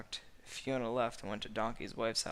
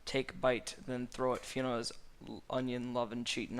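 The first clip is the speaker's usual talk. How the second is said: ordinary delivery, nothing marked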